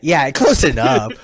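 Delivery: ordinary delivery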